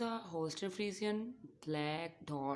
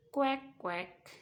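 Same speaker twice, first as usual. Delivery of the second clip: goose quack; 7 semitones higher, 5 dB louder than usual. Pretend. Imitating the goose.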